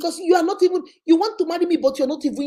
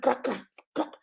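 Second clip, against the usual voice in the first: usual speaking voice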